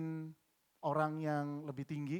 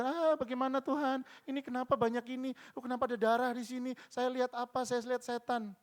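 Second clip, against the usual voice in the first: higher, panicky mock fright; mock frightened; 8 semitones higher than usual. Preacher imitating a scared man seeing demons, high and panicky. Frightened person voiced in story.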